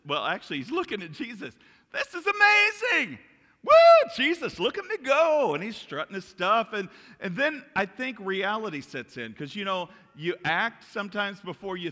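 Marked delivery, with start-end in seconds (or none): 1.9-5.5 very high, loud mock elation; hammed-up thrilled disciple; exhilarated whooping. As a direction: whoop and show off with glee. Peter acted, thrilled on the water.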